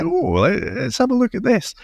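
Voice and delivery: high, gleeful mock intrigue; playfully re-enacted eagerness; eager curiosity. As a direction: lift it high like you can't resist. Acting out his eager curiosity.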